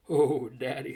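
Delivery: lightly hammed-up swoon; mock swoon. A narrator's reading. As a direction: play at being smitten. Stokesy mock-swooning over the girls.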